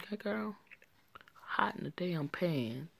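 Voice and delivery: hushed gossiping aside; whisper. Real, not acted. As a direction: whisper it like a secret to the viewers. Aside to viewers about character.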